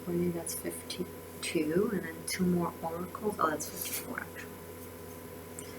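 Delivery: whispered aside to herself; muttered whispered recount. Real, not acted. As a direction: whisper the correction under your breath. Counting cards quietly to herself.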